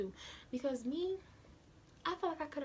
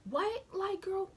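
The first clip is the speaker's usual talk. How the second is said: much higher, louder, rising; shocked disbelief; 10 semitones higher, 4 dB louder, slower than usual. Retold or imitated. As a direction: jump up in disbelief. Shocked at girl's message.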